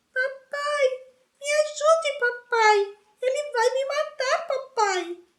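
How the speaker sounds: Dudley mocks Harry's nightmare calls in falsetto; mock falsetto whimper. A narrator's reading. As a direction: whine like a scared child calling dad. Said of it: "fez uma voz de falsete e lamúria".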